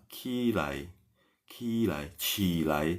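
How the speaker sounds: qilai said softly, falling; qilai demonstrated. Shown as a demonstration. Teacher modelling the Mandarin word for students, softly. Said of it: "bangun itu apa".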